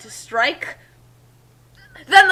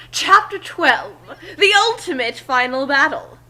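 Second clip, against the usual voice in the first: excited; 4 semitones higher, 5 dB louder than usual. Acted. Narrator redoes the chapter title, dramatic. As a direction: announce it like an epic trailer. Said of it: "that is sort of cool".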